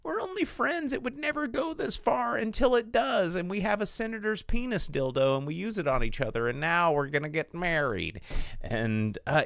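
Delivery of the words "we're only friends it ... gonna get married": high, mock-innocent rom-com heroine; mocking rom-com lines. Mocking rom-com tropes to co-host, high and coy.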